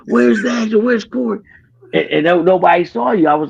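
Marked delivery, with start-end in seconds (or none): 0.1-1.4 high-pitched fretful imitation; mock a frazzled friend hunting for people; anxious, raised-pitch questions, retold; nervous wreck. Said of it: "He's nervous wreck".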